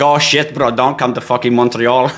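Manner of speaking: joking French accent, badly faked. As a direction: put on a clumsy French-Canadian accent. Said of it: "let me say it in my best French accent".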